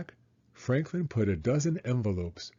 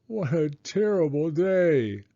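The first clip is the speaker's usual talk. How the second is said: wailing; 7 semitones higher than usual. A narrator's reading. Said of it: "wailed Franklin".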